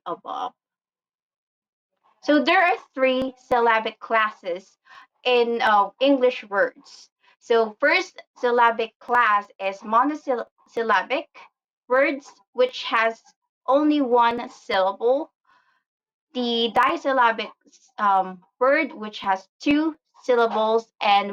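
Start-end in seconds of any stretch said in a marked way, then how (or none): none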